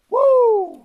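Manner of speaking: high, loud celebratory whoop; joyful whoop. Real, not acted. Hiker celebrating making it to the other side, loud whoop. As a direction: shout it out in real triumph. Cheering after crossing to campsite.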